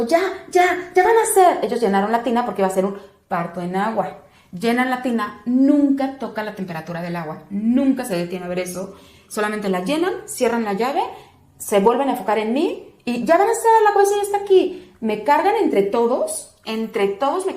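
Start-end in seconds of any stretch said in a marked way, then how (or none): 0.0-1.6 high, loud retold exclamation; urgent announcement. Attendant alerts the room during labour, high urgent call. 13.2-14.9 high, loud retold call-out; urgent announcement. Attendant announces the baby's head, high urgent shout.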